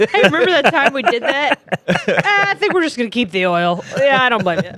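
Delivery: put-on leader voice, laughing; lightly voiced smug leader; mock world leader. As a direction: play a smug head of state.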